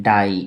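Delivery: the second डाई slow, with a long "aai"; pronunciation demo. Shown as a demonstration. Teacher showing how this is pronounced, slow stretched डाई. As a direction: draw out the vowel of डाई. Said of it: "इसको बोलेंगे".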